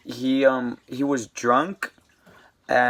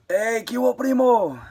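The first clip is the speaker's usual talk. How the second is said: put-on higher-pitched Mexican-accented greeting; Mexican cellmate voice; 10 semitones higher than usual. Mexican cellmate greeting the new guy in a higher Mexican accent. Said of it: "you know how Mexicans, they talk".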